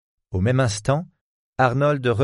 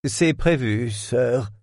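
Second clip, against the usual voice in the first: offended butler; 2 semitones higher, slower than usual. A narrator's reading. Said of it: "répliqua le butler offensé".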